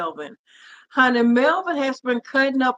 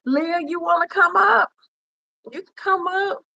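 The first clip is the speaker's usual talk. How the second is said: higher, raised call with a laugh; 8 semitones higher than usual. Real, not acted. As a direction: call her up excitedly. Calling a viewer to join.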